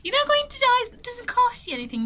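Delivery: high-pitched playful coaxing; high teasing. Acted. Teacher coaxing viewers to click the bell, high and teasing. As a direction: tease the viewer in a high squeaky voice.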